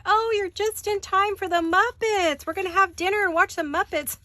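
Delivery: high, loud, gushing welcome; imitating an excited family greeting; sing-song excitement at the Muppets on TV; excited mom. Boyfriend's family greeting her.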